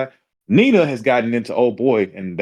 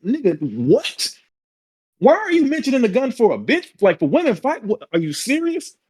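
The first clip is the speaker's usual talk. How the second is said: high-pitched retold disbelief; incredulous; 11 semitones higher than usual. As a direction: question him as if he's lost it, pitch climbing high. Incredulous reply to gun threat.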